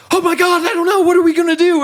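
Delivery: shrill-high, loud hammed-up panic of a helpless captain; mock captain panic. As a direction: wail it like a captain losing it completely. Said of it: "If the captain starts freaking out".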